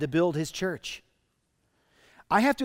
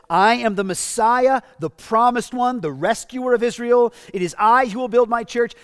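loud, raised, commanding declaration; proclaiming as Jesus; 6 semitones higher, 8 dB louder than usual. Acted. Pastor voicing Jesus declaring himself Messiah, loud and raised. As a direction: boom it out like a king announcing himself. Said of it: "Jesus just says".

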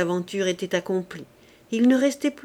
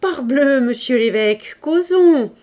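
cheerful tipsy senator calling out; a senator a little merry after dessert, loudly hailing the bishop; exclaiming loudly; 4 semitones higher, 6 dB louder than usual. A narrator's reading. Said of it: "s'écria".

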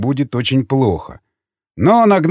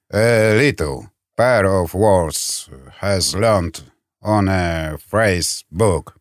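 very slow, lower-pitched, softening; halting speech; 6 semitones lower, much slower than usual. A narrator's reading. Elder explaining his few phrasebook words, halting speech. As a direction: search for each word, slowly and low. Elder struggling with a foreign language.

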